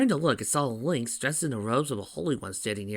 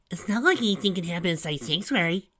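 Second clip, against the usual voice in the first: put-on high, louder kid voice; pitched-up little brother brushing it off; unconvinced kid sidekick; 9 semitones higher, 5 dB louder than usual. A narrator's reading. Tails reassures Sonic outside Sanctuary, unconvinced. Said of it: "his voice lacked a certain convention".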